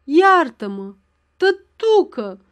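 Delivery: high, slow begging plea; a girl pleading under blows; pleading cry. Acted. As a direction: beg your father for mercy, drawn out. Daughter begging beaten by father.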